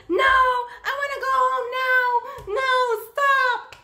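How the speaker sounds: imitating a child crying to go home right now; mimicked crying child. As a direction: howl it like a toddler mid-tantrum. Retold child demanding to go home.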